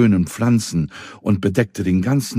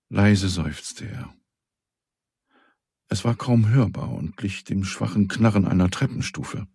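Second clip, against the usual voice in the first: ordinary delivery, nothing marked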